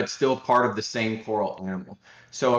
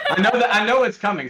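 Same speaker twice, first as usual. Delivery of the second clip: laughing; 11 semitones higher, 7 dB louder, faster than usual. Real, not acted. Laughing at chat's meme.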